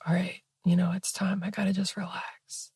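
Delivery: soft whispered self-reassurance; calming whisper. Retold or imitated. Settling herself into the bath, soft whisper. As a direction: whisper yourself down into calm.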